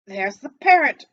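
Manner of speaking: loud, high delighted shout; excited cry. A narrator's reading. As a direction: call out in glee at the parrot. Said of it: "cried Scrooge".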